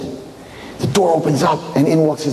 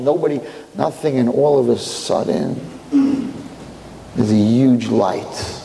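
plain reading voice

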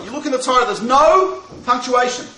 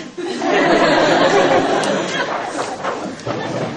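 laugh; 8 semitones higher than usual. Real, not acted. Laughs at punchline.

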